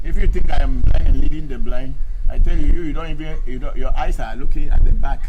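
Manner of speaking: loud, fast rebuke; angry lecture to the commenter; heated scolding. Real, not acted. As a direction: scold him for not seeing. Continued angry rebuttal.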